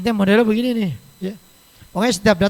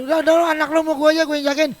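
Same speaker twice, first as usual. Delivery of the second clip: higher, fast eager offer; eager grandmother offering; 6 semitones higher, faster than usual. Retold or imitated. Grandmother eagerly offering to mind the baby, higher and fast. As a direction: rush the offer brightly, pitched up.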